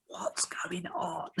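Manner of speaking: slow, drawn-out stage whisper; exaggerated whispered question. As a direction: whisper it theatrically, stretching it out. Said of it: "Stage whisper".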